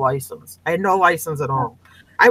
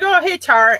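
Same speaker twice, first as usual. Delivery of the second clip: cheery greeting; 10 semitones higher, 4 dB louder than usual. Retold or imitated. Cop recognizes her, friendly.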